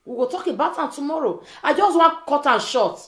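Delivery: ordinary talk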